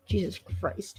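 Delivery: dry throat from popcorn. Real, not acted. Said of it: "throat gets dry".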